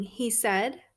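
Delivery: ordinary delivery, nothing marked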